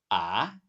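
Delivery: "ah" with a rising tone; second tone. Shown as a demonstration. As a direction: lift "ah" like a question. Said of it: "let's sing ah with the four tones".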